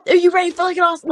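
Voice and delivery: high-pitched hype-man announcer. Retold or imitated. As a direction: pump up the crowd like a hype man. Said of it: "these hype men, like, narration".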